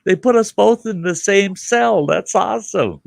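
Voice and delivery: put-on high, loud, delighted kid voice; gleeful like a little boy; excited kid. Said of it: "I said".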